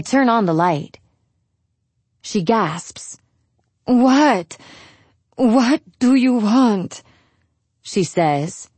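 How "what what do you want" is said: mildly blurry, drawn-out, higher voice; sleepy mother. A narrator's reading. Mother woken by her daughter, blurry with sleep. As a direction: answer as if just woken, irritated. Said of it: "her voice blurry with sleep".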